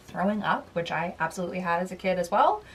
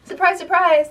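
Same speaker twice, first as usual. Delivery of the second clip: high, loud, playfully ironic "surprise, surprise"; mock surprise; 9 semitones higher, 9 dB louder than usual. Book vlogger to viewers, mock surprise at a repeat winner. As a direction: act shocked at something obvious, tongue in cheek.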